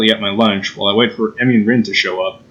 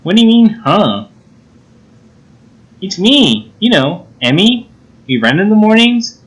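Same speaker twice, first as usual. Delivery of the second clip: put-on higher, indignant girl's voice; perky put-out Emmy; 7 semitones higher than usual. Emmy to a forgetful friend, put out. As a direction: remind him who you are, pitched up.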